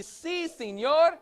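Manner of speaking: si, senor called high and loud in a Spanish accent. Shown as a demonstration. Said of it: "good Spanish accent".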